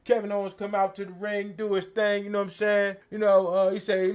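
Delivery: ordinary delivery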